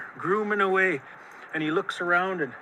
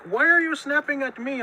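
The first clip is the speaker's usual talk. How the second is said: raised, affronted question; indignant challenge; 6 semitones higher than usual. A narrator's reading. Coyote confronts snapping Rattlesnake, indignant. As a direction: challenge with mild indignation, pitched up.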